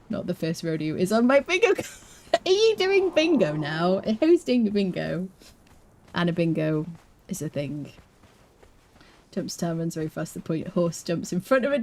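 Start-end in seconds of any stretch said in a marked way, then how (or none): none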